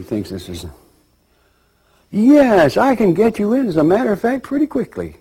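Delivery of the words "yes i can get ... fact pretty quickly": high, loud, eager clerk; eager yes. Retold or imitated. Clerk seizing on the soul's request, high and eager. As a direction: jump at the sale, pitched way up.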